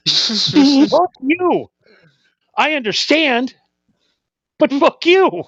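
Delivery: high, mock-outraged retort; mock indignant fuck you. Guest snaps back at the drummer, mock-outraged and high. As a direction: spit it back, pitched high in comic outrage.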